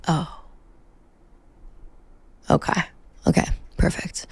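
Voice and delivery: quiet, stunned okays; deflated. Retold or imitated. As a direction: let the air out, barely voiced. Deflated reaction to kids.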